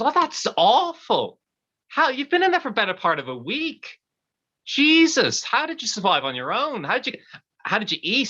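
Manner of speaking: high-pitched, incredulous questioning; shocked concern. Acted. Husband questions the man trapped inside, high shocked concern. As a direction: react aghast, pitch jumping high.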